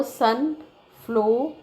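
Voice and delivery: "sunflower" slowly in separated pieces, sun, flow, er; "sunflower" said piece by piece with pauses between syllables; split into syllables. Shown as a demonstration. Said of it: "is a split into two syllable".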